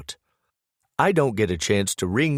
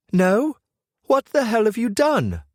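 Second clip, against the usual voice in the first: angry outburst; 6 dB louder than usual. Acted. Brooke bursts out on phone.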